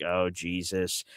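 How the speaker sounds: low, soft, slow dismay; retold sinking-heart aside. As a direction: sigh it to yourself, voice dropped.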